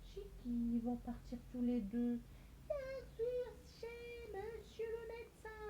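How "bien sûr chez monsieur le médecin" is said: put-on very high, soft child voice; pretend pupil answer. Teacher playing a pupil who gives the answer, high and soft. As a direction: answer sweetly like a little pupil.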